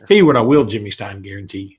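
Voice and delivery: ordinary delivery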